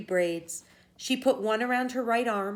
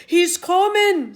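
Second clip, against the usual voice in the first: loud, high, slow cry of alarm; panicked; 11 semitones higher, 12 dB louder, slower than usual. Acted. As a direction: gasp it out in terror. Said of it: "said Finn, his face turning pale green".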